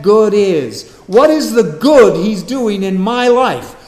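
loud, rising sermon climax, hammering each phrase; full-force preaching. Acted. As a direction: preach it out to the back row, full voice.

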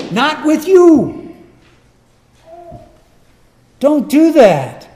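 loud, high, slow rebuke; slapping with words. Acted. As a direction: drive each word home forcefully. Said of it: "he's gonna slap them with words".

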